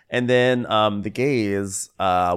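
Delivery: usual speaking voice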